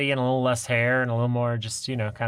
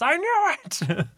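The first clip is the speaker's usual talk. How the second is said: high, drawn-out gotcha cry; mock triumphant cry; slower than usual. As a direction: crow it high and slow, hamming up the triumph. Acts out showing up at the house.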